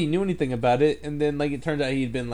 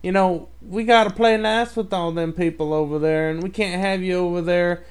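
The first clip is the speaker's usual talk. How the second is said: put-on old prospector; 4 semitones higher than usual. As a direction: play a cranky old-timer. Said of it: "somebody that's, like, seventy years old".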